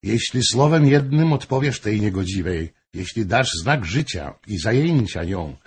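usual speaking voice

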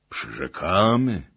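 loud, drawn-out echo of a pledge; princes echoing promise. A narrator's reading. Princes repeating the princess's promise, loud and drawn out. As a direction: repeat the pledge slowly, like a chorus. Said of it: "powtórzyli kniaziowie".

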